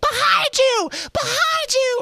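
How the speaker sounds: piercing high-pitched shout; screaming kids. Retold or imitated. Kids screaming at the performers on stage, shrill screaming. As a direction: shriek it like an over-eager child audience. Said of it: "the kids are screaming at you".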